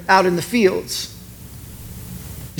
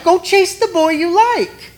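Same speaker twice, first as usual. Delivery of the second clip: put-on much higher, slow girlfriend voice; egging on; 10 semitones higher than usual. Girlfriends urge her to chase the boy, high egging-on. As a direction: cheer her on in a high, drawn-out voice.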